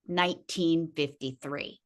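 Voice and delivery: usual speaking voice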